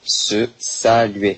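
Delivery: very slow, drawn out; slow dictation. Shown as a demonstration. Teacher dictating slowly while writing on the board. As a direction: stretch it out so they can write it. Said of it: "je vous l'écris ici".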